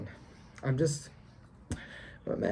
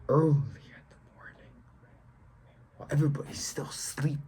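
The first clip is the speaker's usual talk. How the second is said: hushed whisper; 3 semitones lower than usual. Acted. Gym-goer on early mornings while everybody's still sleeping, hushed whisper. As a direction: drop into a whisper.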